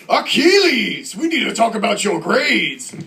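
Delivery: mock-epic high, loud shout, name stretched; hammed-up theatrical bellow; bellowing dad. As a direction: call a kid to dinner like a movie warlord. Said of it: "what if a kid nowadays was named".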